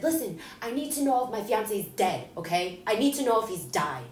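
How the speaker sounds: loud, high, desperate demand; reliving a bugged-out outburst short of a scream; retold screaming. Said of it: "screaming and bugging out".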